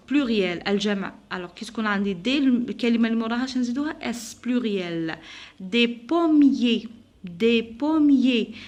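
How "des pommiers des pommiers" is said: "Des pommiers" drawn out, no final s; "des pommiers" in slow syllables, both S silent. Shown as a demonstration. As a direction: dictate "des pommiers" slowly as dé po-mié. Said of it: "je prononce pas la lettre".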